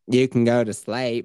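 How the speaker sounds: slow, drawling trashy-mom impression; drawn-out mock mama voice.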